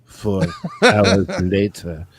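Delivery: low, slow title-card announcer; hammed-up cartoon narrator, deep and drawn out; SpongeBob narrator. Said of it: "the narrator of SpongeBob".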